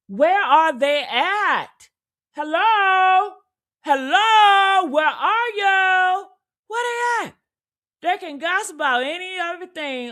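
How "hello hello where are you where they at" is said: higher, raised calls into the void; mock calling out. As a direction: call out as if searching an empty room. Calling out for absent celebrities.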